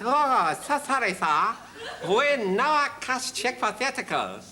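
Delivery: very high, slow mock Chinese accent; caricatured Chinese accent, apologetic. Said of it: "I love that Chinese accent".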